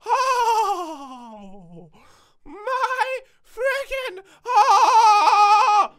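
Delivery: slow high crying shout; panicked wail. Real, not acted. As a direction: wail in terror. Crying out after scare.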